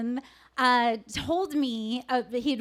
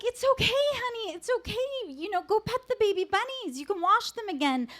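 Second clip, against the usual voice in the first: soothing mother; 8 semitones higher, faster than usual. Retold or imitated. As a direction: coax him gently, like a mother comforting. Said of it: "I was like".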